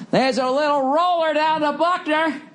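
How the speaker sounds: high, far announcer voice for the famous call; play-by-play call. Retold or imitated. Red Sox fan recalls the Buckner play, announcer call. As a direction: call it like the broadcast booth.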